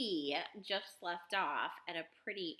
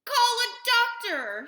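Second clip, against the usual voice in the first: high, loud, slow shout for help; panicked father shouting; 13 dB louder, slower than usual. A narrator's reading. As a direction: shout it urgently, stretching the words. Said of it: "shouted Mister Beauregard".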